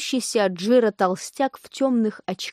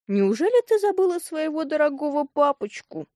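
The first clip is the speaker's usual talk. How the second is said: high, plaintive wheedling; whiny, tearful; 6 semitones higher than usual. A narrator's reading. Impostor father pleads with the girl, whiny and tearful. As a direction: whine it as if about to cry. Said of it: "сказал толстяк плаксивым голосом".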